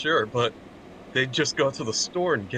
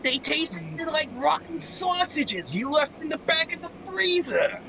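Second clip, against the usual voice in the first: squeaky cartoon voice. Riffing on the South Park bit in a very high squeak. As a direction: squeeze it up as high as it goes.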